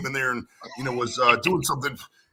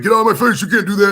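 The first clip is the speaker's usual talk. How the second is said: loud, high mock confrontation; angry shout; 9 semitones higher, 10 dB louder than usual. Cop shouting at a camera filming him, angry yell. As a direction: yell it like a cornered cop getting filmed.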